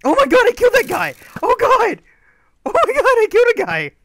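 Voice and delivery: loud, very high screaming excitement; ecstatic shriek. Real, not acted. Player stunned by their own kill, loud high shriek.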